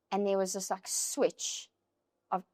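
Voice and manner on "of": normal talking voice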